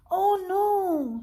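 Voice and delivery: high, startled oh no; dismayed gasp. Acted. Biff sees the books crash onto the little house, high dismayed cry.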